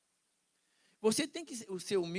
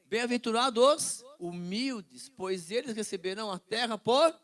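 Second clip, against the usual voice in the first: louder, slow proclaiming of Bem-aventurados; proclaimed verse; 5 semitones higher, 9 dB louder than usual. Shown as a demonstration. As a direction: declaim Bem-aventurados humildes slowly like scripture. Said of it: "como assim". (proclamação lenta e mais alta)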